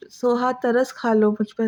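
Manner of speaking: usual speaking voice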